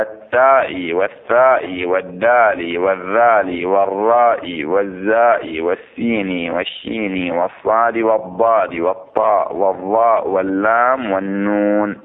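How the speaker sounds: slow, spelled-out list of letters; name each letter slowly and distinctly, like reading a list. Shown as a demonstration. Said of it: "slow listing of sun letters". (تعداد الحروف ببطء)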